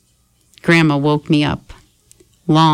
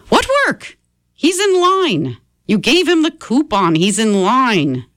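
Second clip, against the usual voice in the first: Grandma; 8 semitones higher than usual. Acted. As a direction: stretch it out high and scornful. Grandma explaining Dad's whereabouts.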